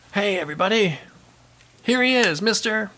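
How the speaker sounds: high, drawn-up mock emcee announcement; event MC. MC introducing the guest speaker to the room, announcer voice. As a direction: imitate an MC hyping the speaker. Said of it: "the M C gets up and he holds the microphone and he goes".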